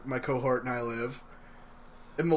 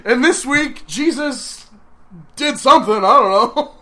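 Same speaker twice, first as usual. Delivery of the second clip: mock news anchor; 9 semitones higher, 9 dB louder than usual.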